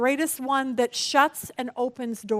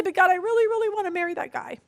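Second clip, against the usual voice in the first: pleading with God; 6 semitones higher than usual. Retold or imitated. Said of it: "You say".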